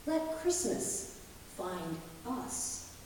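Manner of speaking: ordinary delivery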